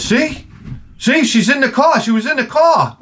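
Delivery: high-pitched mock protest; indignant husband. Old man shows off his wife to the cashier, high indignant cry.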